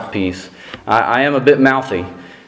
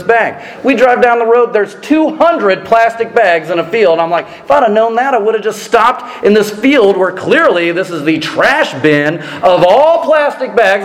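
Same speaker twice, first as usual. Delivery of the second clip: mock outrage; 10 semitones higher than usual. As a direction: rant like a tourist robbed of five cents. Comic indignant rant, high pitched.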